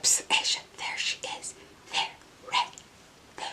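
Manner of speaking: hushed whisper tipping her off. Retold or imitated. Coworker secretly pointing out the star, whispered. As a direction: lean in and breathe it: psst, there she is. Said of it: "one of the girls from Pure Lease was like".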